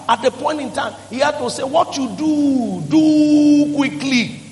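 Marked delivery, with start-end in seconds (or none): 1.6-4.4 very slow, higher, stretched out; slow deliberate command. Acted. Jesus tells Judas to act, very slowly and higher. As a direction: spell each word out slowly.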